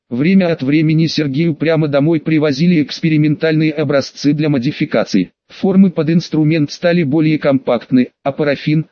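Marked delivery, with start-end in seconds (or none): none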